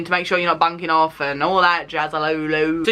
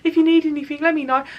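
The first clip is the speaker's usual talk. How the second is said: sympathetic texters; 7 semitones higher, faster than usual. Retold or imitated. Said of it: "the people that were texting me going".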